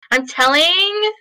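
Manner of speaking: drawn-out, high sing-song tattle; playful tattling. Pretend. Co-host teasing friend about pirating, sing-song tattling. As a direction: tease like a kid telling on someone.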